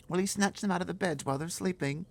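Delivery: put-on a small girl pleading to stop the giants; high girl's voice. Girl begging the giant, high and loud.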